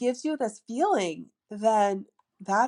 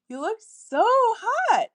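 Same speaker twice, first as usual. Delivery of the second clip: much higher, slow and louder, lilting; playful lilting voice; 12 semitones higher, 5 dB louder, slower than usual. Shown as a demonstration. Telling a guy he looks hot, high playful lilt. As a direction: swoop way up on hot, teasing. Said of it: "fun, lilting, feminine, playful voice".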